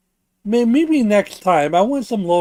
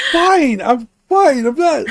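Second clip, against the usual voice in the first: indignant; 6 semitones higher, 5 dB louder, slower than usual. Real, not acted. Offended friend snaps back.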